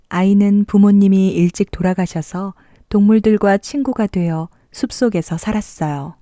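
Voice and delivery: usual speaking voice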